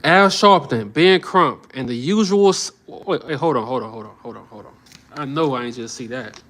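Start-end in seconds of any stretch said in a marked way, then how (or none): 2.9-4.7 falling from soft to near silent; distracted murmur dying away; hold on, fading. Real, not acted. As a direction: trail off while staring at the screen. Stops short at shocking text.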